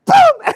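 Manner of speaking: high, loud exclaimed boom; imitated boom. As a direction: punch out a sudden boom. Climax of horse rescue story.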